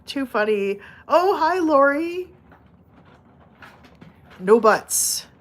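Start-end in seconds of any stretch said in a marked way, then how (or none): none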